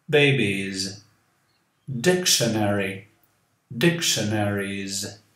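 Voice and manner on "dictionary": normal talking voice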